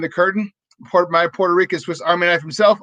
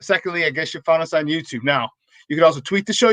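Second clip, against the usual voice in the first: ordinary delivery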